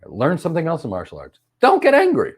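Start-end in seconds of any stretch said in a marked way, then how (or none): 1.6-2.3 emphatic. Real, not acted. Emphatic instruction.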